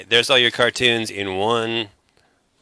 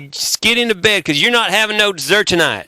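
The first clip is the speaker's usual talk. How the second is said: high hammed-up country accent scold; Southern scolding; 9 semitones higher than usual. Bandmate playing a Southern dad scolding a boy. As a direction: wag a finger, country style. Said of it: "You got authentic".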